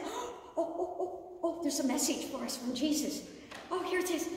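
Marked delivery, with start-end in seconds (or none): 0.0-1.6 gasping, laughing oh's; delighted startled gasp; surprised gasp. Acted. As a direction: act amazed at the discovery. Finds message in tomb.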